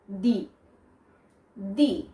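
দি said as "dee", with a long ee; clear pronunciation. Shown as a demonstration. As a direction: say দি alone, distinctly, rhyming with "see". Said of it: "The pronunciation is not die".

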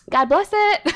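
loud, high mimicry of coworkers; mock coworker. Coworkers correcting her cussing at work, loud high mocking imitation. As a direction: pipe up loudly, imitating a prim correction. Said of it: "they'll be like".

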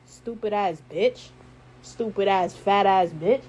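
slow, low, disgusted insult; contemptuous low mutter. Real, not acted. Insulting the girl on live.

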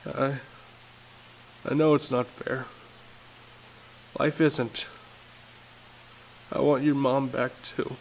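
father comforting his son, near crying; near tears. Acted. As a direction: hold back the tears. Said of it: "He felt like crying too".

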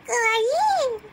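excited anime fan. Pretend. Gushing over anime avatar.

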